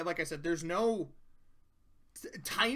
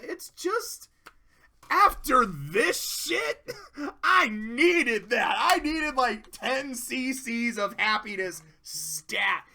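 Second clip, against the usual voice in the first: emotional; 5 semitones higher, 6 dB louder, slower than usual. Real, not acted. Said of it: "I'm tearing up a little bit".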